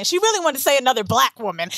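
usual speaking voice

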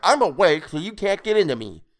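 high, loud creature taunt; crying out. A narrator's reading. Creature telling attacker he cannot get in, crying out. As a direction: crow it at the attacker. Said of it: "Toadface cried".

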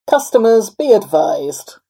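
booming formal address to passengers; PA announcement. A narrator's reading. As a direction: project it to the whole train, deliberately. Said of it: "over the P A system".

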